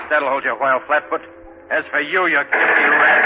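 loud, vicious sneer; vicious snarl. Acted. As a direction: spit it at them. Gangster shoots agents.

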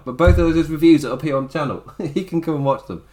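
usual speaking voice

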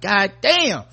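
ordinary talk

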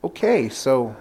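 drawn-out reluctant okay; slow hesitant handover. Retold or imitated. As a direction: hand it back awkwardly. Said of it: "I'm like".

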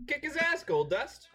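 high cheer for the wrestler. Real, not acted. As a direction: egg him on.

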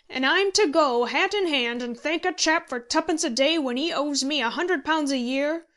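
loud, high-pitched outrage; building, incredulous tirade; indignant exclamation. A narrator's reading. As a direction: rant at the sheer injustice. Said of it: "exclaimed Handy".

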